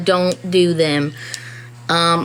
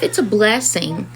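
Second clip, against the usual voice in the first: normal talking voice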